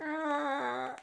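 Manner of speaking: high, held, restrained scream; mock scream. Imitating Magenta as she rips Gerda, mock scream.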